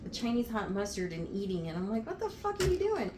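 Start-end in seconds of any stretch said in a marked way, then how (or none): 2.0-3.1 high, disbelieving outburst; can't believe what she sees, pitch jumps high; incredulous. Retold or imitated. To the boyfriend dipping a carrot in mustard, high incredulous outburst.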